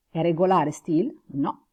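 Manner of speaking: ordinary talk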